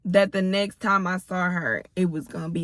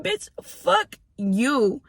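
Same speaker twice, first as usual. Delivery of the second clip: drawn out, very slow, contemptuous; slow dismissive put-down; 5 semitones higher, much slower than usual. Retold or imitated. Telling off the other mom, slow contemptuous put-down. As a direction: stretch every word out to dismiss her.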